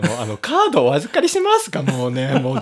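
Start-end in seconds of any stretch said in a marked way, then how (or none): 0.4-1.8 high, sing-song clerk voice; imitating a store clerk. Retelling a funny scene to a co-host, high clerk voice. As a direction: mimic the actor's shop assistant line, pitched way up. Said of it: "言い方すごいよな".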